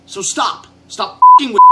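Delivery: loud, angry scolding. Real, not acted. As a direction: tell them off, loud. Drunk rant at the studio.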